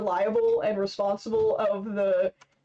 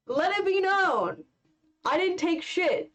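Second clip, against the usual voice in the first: ordinary talk, nothing marked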